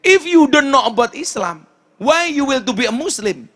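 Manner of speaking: high-pitched, incredulous questioning; retold surprise. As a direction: re-enact being taken aback, pitch jumping up. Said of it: "Saya kaget".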